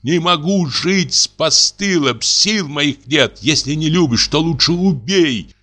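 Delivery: high, slow, tearful plea; pleading through tears. A narrator's reading. Woman begging the man she loves, sobbing. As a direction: beg him, voice breaking with sobs. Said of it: "трясётся, плачет".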